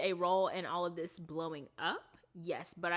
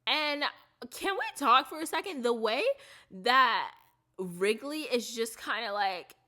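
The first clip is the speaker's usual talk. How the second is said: much higher and louder, eager; excited outburst; 6 semitones higher, 8 dB louder than usual. Real, not acted. Breaking off to gush to viewers, excited. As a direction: burst in with excitement.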